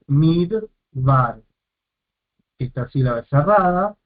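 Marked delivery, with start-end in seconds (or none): 0.1-1.4 "Midbar" con pausa en medio, mid... bar; separado en sílabas. Shown as a demonstration. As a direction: divide "Midbar" en sus dos sílabas. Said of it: "está dividiendo sílaba". ("Midbar" sílaba por sílaba, como profesor)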